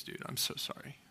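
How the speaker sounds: hushed, near-whispered apology; retold tearful apology, barely voiced; fighting back tears. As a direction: imitate a choked-up friend apologizing quietly. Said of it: "he's fighting back tears".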